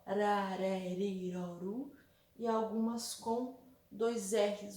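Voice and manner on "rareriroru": slow, drawn-out tapped R in "rareriroru"; single soft R. Shown as a demonstration. Teacher shows pupils the single R, slow tapped "rareriroru". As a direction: roll out "rareriroru" slowly with one light tongue-tap per R. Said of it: "com um R só".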